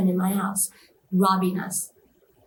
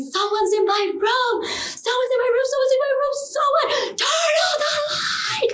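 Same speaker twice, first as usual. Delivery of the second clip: frantic high-pitched scream; really screaming. Retold or imitated. Patient begging for the light, frantic screaming. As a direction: scream it over and over, desperate for help. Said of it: "Like, really screaming".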